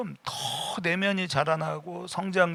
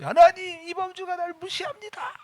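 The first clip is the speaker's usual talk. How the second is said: high, thin mock whine to God; whiny complaining prayer. Pastor mock-tattling to God in a high whine. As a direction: pitch it way up like a petulant child tattling.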